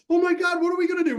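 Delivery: high frantic wail; hammed-up fretting, very high; mock panic. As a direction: freak out over the phone. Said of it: "I'm like".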